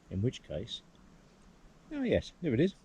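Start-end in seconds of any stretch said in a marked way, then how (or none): 1.9-2.7 oh yes, jumps much higher in sudden recognition; light surprise at finding the peak; a delighted spotting, pitch leaping up; pleased discovery. Real, not acted. Spotting the mountain he sought.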